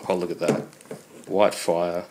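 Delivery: usual speaking voice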